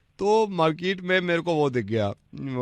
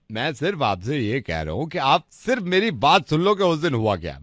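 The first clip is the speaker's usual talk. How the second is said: usual speaking voice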